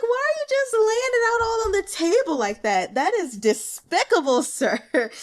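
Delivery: high and loud, mock outrage with a laugh; scandalized outburst. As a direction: tell him off in comic disbelief. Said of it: "I was like".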